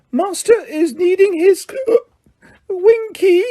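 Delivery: very high, slow whimpering squeak; high whimpering house-elf voice. A narrator's reading. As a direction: whimper it like a drunk, lost elf. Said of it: "whimpered the elf".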